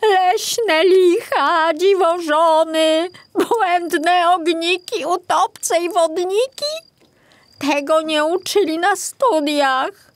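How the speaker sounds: put-on young forester protesting to the old one; exasperated young forester. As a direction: list the creatures as if it's absurd. Piątek's voice, continuing.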